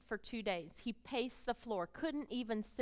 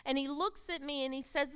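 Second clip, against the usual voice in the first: ordinary talk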